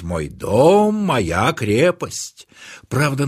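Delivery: plain reading voice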